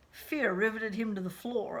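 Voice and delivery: ordinary delivery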